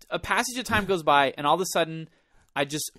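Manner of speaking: ordinary talk, nothing marked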